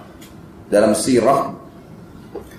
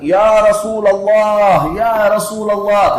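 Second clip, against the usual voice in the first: higher, louder, slow call across a crowd; retold calling shout; 6 semitones higher, 4 dB louder, much slower than usual. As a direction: draw out the call as if shouting from afar. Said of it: "Abu Bakar sengaja teriak".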